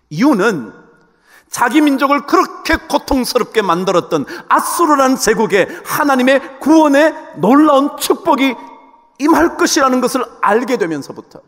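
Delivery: high, loud, impassioned sermon voice; forceful preaching. Acted. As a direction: preach it with full indignant force.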